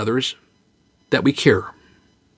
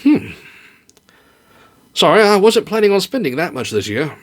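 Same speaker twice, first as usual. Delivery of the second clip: wry husband; 5 semitones higher than usual. Acted.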